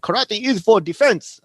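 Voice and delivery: hammed-up Japanese-accented sensei line; horrible Mister Miyagi accent. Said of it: "very horrible accent".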